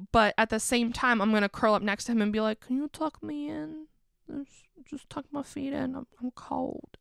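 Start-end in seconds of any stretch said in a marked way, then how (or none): 2.6-7.0 put-on high, soft babyish whine; baby talk. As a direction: plead like a small child being tucked in. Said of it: "we talk in baby talk".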